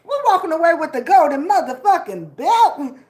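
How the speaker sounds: loud triumphant boast; hype shout. Real, not acted. Boasting to the stream, loud and triumphant. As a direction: shout it like you won. Hyping up the crowd.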